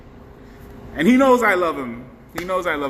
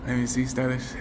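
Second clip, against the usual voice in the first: ordinary delivery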